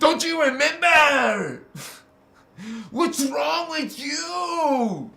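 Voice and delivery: high, drawn-out exasperated cry; mock scolding. Acting out Guardian scolding forgetful avatars, mock exasperated cry. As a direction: wail it as a hammed-up telling-off. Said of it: "Guardian doesn't speak like that".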